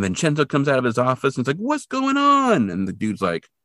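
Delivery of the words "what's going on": high, drawn-out cry of alarm; alarmed question. Retold or imitated. Boss coming out of his office to see phones carried off, alarmed. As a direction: imitate a boss demanding answers, stretched and high. Retelling the boss's startled question.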